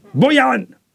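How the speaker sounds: high, sharp call with a falling end; shouted name. A narrator's reading. Chief calling a man out of the ranks, shouting. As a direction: call him out like a boss before the ranks. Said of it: "șeful strigă".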